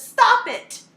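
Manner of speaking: high and loud stern order; a teammate barking at her to stop; sharp command. Retold or imitated. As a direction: cut her off hard. Girl orders her to refocus.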